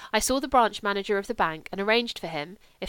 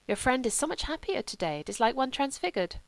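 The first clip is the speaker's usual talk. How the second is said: put-on higher, gentle monk with foreign accent; simple lay brother; 5 semitones higher than usual. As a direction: play a kindly foreign monk.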